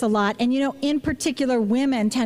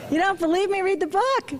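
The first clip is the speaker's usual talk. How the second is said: teasing challenge; 7 semitones higher than usual. Acted. Teasing audience about surprise fact.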